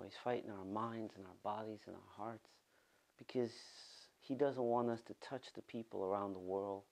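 low, quiet earnest prayer voice. Real, not acted. Pastor praying quietly over the congregation before the sermon. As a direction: pray softly and low over the people.

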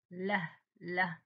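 له sounded slowly twice; slow letter sound. Shown as a demonstration. Teacher to pupils, sounding out له slowly. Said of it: "sounding out the letter".

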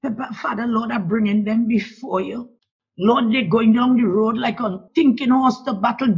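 usual speaking voice

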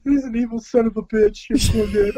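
mock outraged fan yell; mock fan rage. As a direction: rant like an angry old-school wrestling fan. Mock wrestling fan hate.

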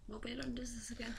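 whisper. Real, not acted. Quick aside while fitting mouthguard.

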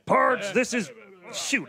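lightly voiced frontier cowboy; a hint of an old-west gunslinger; cowboy drawl. Cowboy character greeting newcomers.

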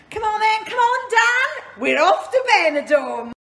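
loud, high holiday excitement; rally everyone, off on holiday; excited. Real, not acted.

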